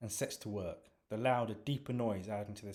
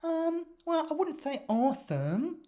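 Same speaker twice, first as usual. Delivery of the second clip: thoughtful; 8 dB louder than usual. Acted. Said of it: "she says thoughtfully".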